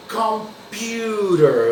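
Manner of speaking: "computer" drawn out slowly, "pu" as "pyoo"; slow English demo. Shown as a demonstration. Teacher models "computer" slowly for students. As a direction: stretch out "computer" for the class. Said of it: "um som mais literal da letra U".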